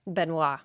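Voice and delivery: "Benoit" said ben-WAH; "Benoit" French-style, ending on WAH; silent final consonants. Shown as a demonstration. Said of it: "with silent S or T".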